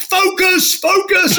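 high shouted command; retold shout. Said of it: "she's shouting back".

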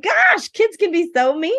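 high, loud whine; playful imitation of her younger hurt self; mock hurt child. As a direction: wail it like a hurt little girl. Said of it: "And I'm like".

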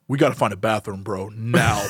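low rumbling gut demanding a bathroom; voice of stomach. Pretend. Stomach urging narrator to find bathroom, low urgent. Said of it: "my stomach was like".

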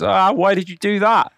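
high, loud, slow cry of betrayal; a frog's pained, drawn-out why; anguished frog. Acted. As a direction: wail it out as the drowning frog. Drowning frog voiced in fable.

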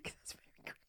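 breathy whispered aside. Real, not acted. Friend whispering a cheeky aside to co-host. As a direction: drop to a whisper mid-laugh.

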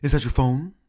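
mild, lightly put-on actor voice; a first try at the impression; William Shatner. Shatner asks us in the hallway, light impression. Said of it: "he looks over and he says".

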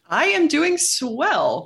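high, slow, drawn-out showman's swell; hammed-up vaudevillian barker greeting; carnival barker. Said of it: "like a vaudevillian carnival barker".

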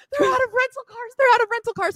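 high, loud panicked mom voice; mom's panic. Retold or imitated. Mom panicking that rental cars are gone, high frantic voice. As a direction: imitate a frantic mother. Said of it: "she was like".

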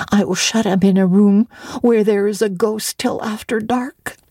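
put-on girl answering the apothecary with stung pride; indignant child. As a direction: protest like a wronged little girl. Child Jane voiced.

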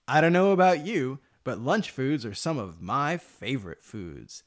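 soft, smooth commercial voice; ad read. Acted. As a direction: sell lunch like a TV spot. Mock lunch commercial read.

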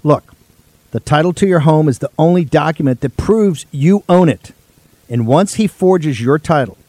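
punchy, loud radio ad read. Acted. Host reading a home title sponsor ad to listeners, loud and punchy. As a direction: sell it like a live radio spot.

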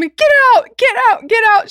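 high, loud repeated command; urgent high-pitched retold shout; mock frantic yell. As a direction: imitate her shouting him out of the room. Acting out patient ordering doctor out.

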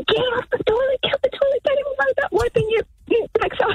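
high, fast mock scream at the sister; retold scream. In a dream, yelling at her sister on the toilet, high mock scream. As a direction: shriek it like a panicked sister in a dream. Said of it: "I'm screaming at my sister saying".

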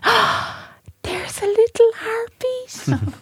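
very high, airy gasp of wonder; mock breathless, high-pitched drawn-out reveal; retold gasping delight. Sonographer finding a heartbeat on the scan, retold gasping delight. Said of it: "And she went".

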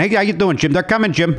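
put-on light Chicago accent, a bit high; a hint of a Chicago guy. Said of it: "in that Chicago accent".

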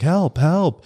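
high-pitched imitated cry for help; mock yell for help. Man held at gunpoint, retold as a mock yell for help. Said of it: "starts yelling for help".

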